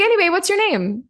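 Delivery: high-pitched chirpy question; breezy imitation. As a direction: imitate a friendly stranger breezing on. Imitating the man's cheerful question.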